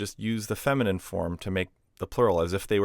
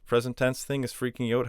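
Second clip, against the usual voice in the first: normal talking voice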